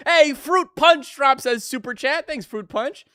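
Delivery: loud, high, excited shout-out. Real, not acted. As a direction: burst out with a big thank-you. Shouting out a Super Chat.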